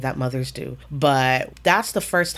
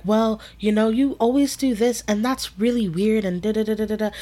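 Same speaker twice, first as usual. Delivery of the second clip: higher-pitched imitation of a friend backpedaling; imitated backpedaling; 6 semitones higher than usual. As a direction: mimic someone making excuses in a raised pitch. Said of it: "trying to backpedal".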